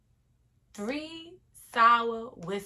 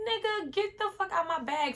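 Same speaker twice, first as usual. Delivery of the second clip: yelling at him; 10 semitones higher than usual. Retold or imitated. Waking to find a bum in her bag, furious yell. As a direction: blast him out of your bag.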